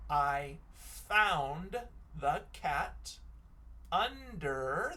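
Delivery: each word spaced out; slow dictation. Shown as a demonstration. Teacher rereads her sight word sentence, spaced-out dictation. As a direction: read it back one word at a time.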